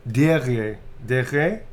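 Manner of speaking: testing how to say "Dire"; trying out "Dire". Shown as a demonstration. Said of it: "I can't remember pronounce it".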